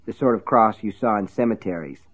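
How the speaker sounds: plain reading voice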